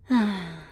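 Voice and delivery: short, falling exhale; heavy sigh. Acted. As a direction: let out an audible sigh. After hugging the tied captive.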